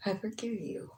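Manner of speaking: quiet sincere forgiveness; tender forgiveness. Retold or imitated. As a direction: say it softly with your arm around him. Peter hugging Matthew, forgiving.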